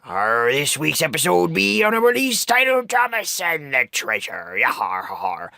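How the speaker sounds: hearty raspy pirate greeting. Acted. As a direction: talk like a rasping old sea pirate. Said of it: "like my raspy pirate voice just said".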